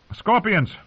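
high, called-out command; sharply pitched battle order. A narrator's reading. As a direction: call the order to the crews. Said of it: "he commanded".

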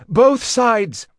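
high, loud command; shouting. A narrator's reading. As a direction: bark it across the hall. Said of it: "Dorian shouted".

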